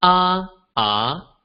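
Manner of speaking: "Ah" high and flat, then "ah" rising; "Ah, ah" as tones one and two; first and second tones. Shown as a demonstration. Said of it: "the four tones of Mandarin Chinese".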